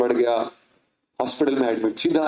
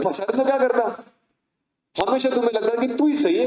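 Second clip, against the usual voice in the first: higher, firm reprimand; scolding lecture; 8 semitones higher than usual. Acted. A brother lecturing his stubborn sister, reproachful. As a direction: set her straight.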